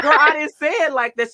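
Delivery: laughing protest to the client. Real, not acted. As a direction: laugh while denying it.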